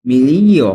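"মিলিও" with the g silent, a long stressed middle "li"; teacher's model "মিলিও", g left unsounded; silent-g pronunciation. Shown as a demonstration. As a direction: say "মিলিও" loudly, gliding from "li" into "o" with no hard g. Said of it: "জি এর উচ্চারণটা উচ্চ থাকবে".

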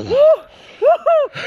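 high, loud whooping; excited whooping. Real, not acted. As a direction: whoop with the thrill. Cold swim thrill.

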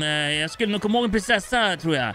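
game character. Acted. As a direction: play a character quizzing the hero.

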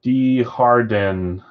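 Desjardins slowly Americanized as "dee-har-den"; American accent. Shown as a demonstration. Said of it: "like, an American accent?".

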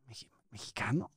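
pregunta titubeante, casi susurrada; duda imitada. Retold or imitated. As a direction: imita a alguien que duda, en voz muy baja. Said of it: "Ya como que lo dudaban". (mexicano dudoso, apenas susurrado)

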